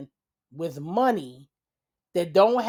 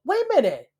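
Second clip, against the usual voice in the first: sudden realization; 9 semitones higher, 4 dB louder, faster than usual. Real, not acted. Sudden realization mid-gossip.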